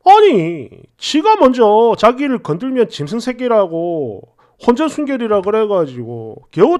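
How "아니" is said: usual speaking voice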